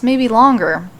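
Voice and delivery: ordinary delivery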